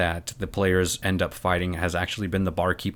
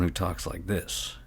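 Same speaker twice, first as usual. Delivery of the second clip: softer and slow, a second NPC; contrasting quiet voice; 2 semitones lower, 9 dB quieter, slower than usual. Shown as a demonstration. DM contrasting two NPC voices, soft and slow. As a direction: drop it soft and drawn out. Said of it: "a lot different than someone".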